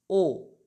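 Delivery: O said closed and round, never open; a short closed O, as in Spanish "ojos"; O pronounced as a closed o. Shown as a demonstration. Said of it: "siempre va a tener este sonido".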